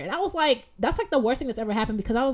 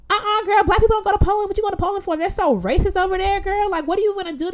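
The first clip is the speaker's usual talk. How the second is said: high, loud, animated warning; imitated insistent stranger; 13 semitones higher, 6 dB louder than usual. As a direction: act out her alarmed, high-pitched warning. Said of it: "She's like".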